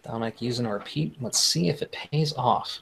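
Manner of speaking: hushed golf announcer voice. Pretend. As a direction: murmur it like a golf broadcast. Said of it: "talking like a golf announcer".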